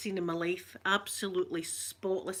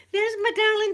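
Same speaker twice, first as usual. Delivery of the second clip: much higher, louder sing-song coo; cooing greeting; 13 semitones higher, 10 dB louder than usual. Real, not acted. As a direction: coo it like greeting a beloved pet. Greeting a pet or baby.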